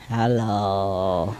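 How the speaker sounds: low, soft, drawn-out hello; dream talk. Retold or imitated. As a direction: imitate a sleep-talking parrot, slow and low. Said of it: "they talk in a dream".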